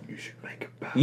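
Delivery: hushed, whispered suggestion; conspiratorial whisper; imagined pitch to Tarantino. Retold or imitated. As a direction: whisper it as a sly little pitch. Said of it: "are they going".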